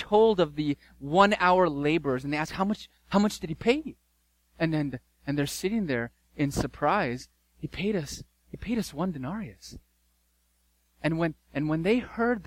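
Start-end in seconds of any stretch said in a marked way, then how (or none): 2.5-3.9 eager workers asking. Acted. 7.6-9.8 surprised hushed reply. Acted.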